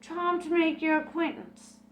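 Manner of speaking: a nervous squeak of politeness. Acted. Shy Julia greets the spirit guide with a high squeak. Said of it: "squeaked Julia".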